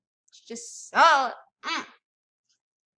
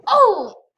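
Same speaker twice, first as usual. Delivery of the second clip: loud oh; 7 semitones higher, 12 dB louder than usual. Real, not acted.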